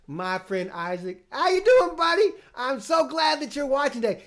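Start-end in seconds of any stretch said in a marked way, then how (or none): 1.3-4.2 much higher, louder welcome; excited greeting. Real, not acted. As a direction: greet little friend Isaac happily. Greeting young viewer Isaac.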